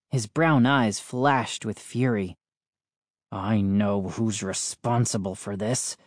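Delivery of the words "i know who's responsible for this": low, quieter bitterness; bitter. A narrator's reading. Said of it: "he said bitterly".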